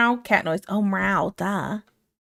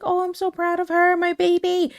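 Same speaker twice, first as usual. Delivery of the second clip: put-on much higher, gushing pride over the baby; proud auntie; 9 semitones higher than usual. Aunt gushing over her niece, high proud coo. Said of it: "really good hearing her".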